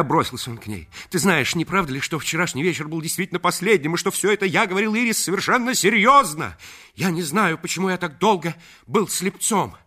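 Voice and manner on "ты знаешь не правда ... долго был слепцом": high, loud and fast declaration; breathless lover's confession; passionate rush. Acted. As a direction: pour it all out, feverish.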